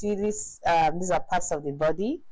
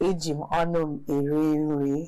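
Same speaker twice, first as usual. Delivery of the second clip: quick repeat; 3 semitones lower, much faster than usual. Shown as a demonstration. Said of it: "fast repeat".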